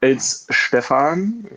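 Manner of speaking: his own name Stefan, slow, with "ah" in the ending; Stefan as SHTEH-fahn. Shown as a demonstration. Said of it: "is it Stefan or Stefan?".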